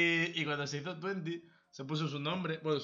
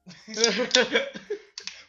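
loud laugh; 9 semitones higher, 12 dB louder than usual. Real, not acted. Burst of laughter.

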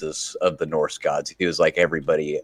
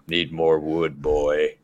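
imitating Kratos; slower than usual. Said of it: "he's just like".

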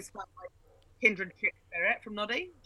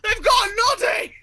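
higher, louder frantic outburst; child's distressed shout; 7 semitones higher, 8 dB louder than usual. Retold or imitated. Panicked child yelling to Mum about Noddy, high and loud. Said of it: "And I'm like".

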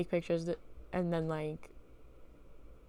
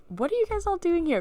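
high, louder acted astonishment; incredulous question; 11 semitones higher, 5 dB louder than usual. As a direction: demand it in hammed-up disbelief. Dream: finding fifty-six men in room.

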